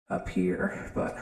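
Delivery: normal talking voice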